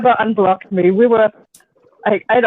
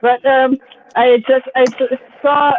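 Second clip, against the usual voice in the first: usual speaking voice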